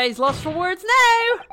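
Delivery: slow, high, loud gloating; crowing taunt. Acted. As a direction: crow loudly over a silenced opponent. Crows over opponent in game.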